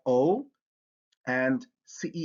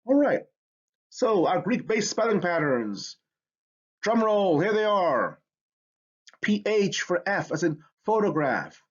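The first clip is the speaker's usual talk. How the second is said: ordinary talk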